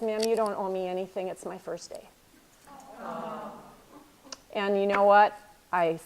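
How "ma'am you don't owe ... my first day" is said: quiet. Retold or imitated. Retelling the cab driver's reply.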